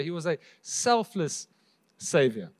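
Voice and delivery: selfless slow and weighted, Savior dropping low; slow down on he was a selfless Savior; stress on selfless. Shown as a demonstration. Preacher emphasizing selfless to congregation, slow weighted stress. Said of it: "emphasize the word selfless".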